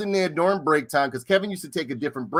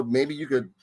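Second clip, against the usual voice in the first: normal talking voice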